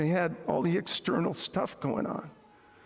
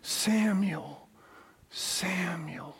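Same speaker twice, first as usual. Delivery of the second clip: calling voice fading to whisper; much slower than usual. Acted. God's voice calls the boy Samuel, fading to a whisper. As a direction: call "Samuel", then whisper the second "Samuel". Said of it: "the voice finally says to him".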